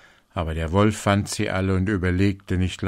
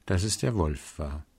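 plain reading voice

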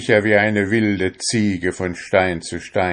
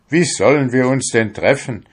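higher, louder shout; 6 semitones higher, 5 dB louder than usual. A narrator's reading. Fisherman shouts at his departing soul. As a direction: cry the question out. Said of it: "schrie der junge Fischer".

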